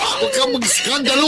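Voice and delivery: high, pleading shout. Acted. Husband begging wife to stop the scandal, shouting. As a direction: yell to stop the scene.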